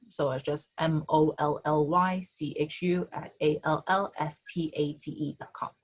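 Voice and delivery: normal talking voice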